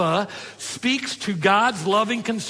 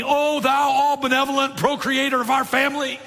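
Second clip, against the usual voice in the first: higher, pompous, grandly formal mock address; 9 semitones higher than usual. Kids mock-formally address their dad, pompous and higher. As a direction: ham up a solemn royal address. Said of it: "my kids addressed me".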